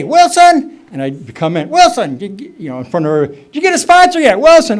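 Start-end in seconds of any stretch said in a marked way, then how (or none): 0.0-0.8 loud, much higher call of the name. Retold or imitated. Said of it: "And he'd say". 1.7-2.2 loud, much higher call of the name. Retold or imitated. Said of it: "And he'd say". 3.3-4.8 fast, loud and much higher demand. Retold or imitated. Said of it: "And he'd say".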